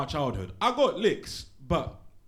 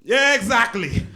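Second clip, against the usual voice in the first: slow, loud and high teacher affirmation; teacher confirming the warning; stern teacher; 9 semitones higher, 11 dB louder, slower than usual. Acted. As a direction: drag it out, unimpressed teacher.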